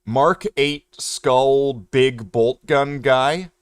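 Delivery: very slow, deliberate note-taking read; dictating a note to self, very slow; slow dictation. Shown as a demonstration. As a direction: spell it out slowly like writing a label. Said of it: "let's take a note".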